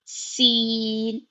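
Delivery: سین drawn out slowly with a hiss; a long sibilant سین; whistling seen. Shown as a demonstration. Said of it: "سیٹی کی آواز آئے گی".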